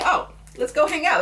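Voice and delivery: bright high mock invite, sing-song; eager invitation. New friends at a bar inviting her, high eager mock invite. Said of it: "it's like".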